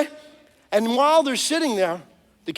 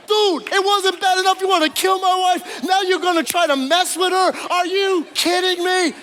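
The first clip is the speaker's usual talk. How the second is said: angry king; 6 semitones higher than usual. Acted. King catches Haman on Esther.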